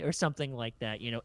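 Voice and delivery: ordinary delivery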